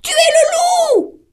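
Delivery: very high, fast little goat voices, shouting at the end; squeaky kids accusing the wolf; young goats refusing the wolf, very high and loud. A narrator's reading.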